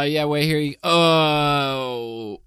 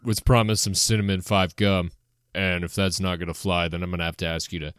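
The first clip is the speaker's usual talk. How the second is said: lower, stern manager voice; deep manager voice; 8 semitones lower than usual. Acted. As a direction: speak low as the boss.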